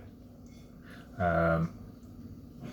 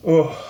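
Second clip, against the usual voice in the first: pained gasp; 8 semitones higher, 8 dB louder than usual. Real, not acted. Burning chili pain reaction.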